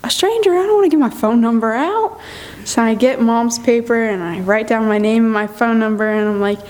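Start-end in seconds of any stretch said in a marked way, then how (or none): 0.0-2.2 high, disbelieving retort; incredulous objection. Retold or imitated. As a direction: balk at mom's suggestion, pitched up. Retold objection to mom's suggestion.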